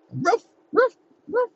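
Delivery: high imitated barks in a row; dog barks. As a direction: answer with short high barks, fading. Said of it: "Come here, Willard".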